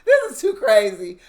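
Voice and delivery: exasperated. Retold or imitated. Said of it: "I said".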